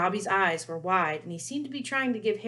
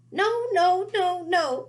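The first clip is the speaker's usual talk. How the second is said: much higher, louder squeak; squeaking house elf; 12 semitones higher, 9 dB louder, much slower than usual. Acted. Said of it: "squeaked Dobby".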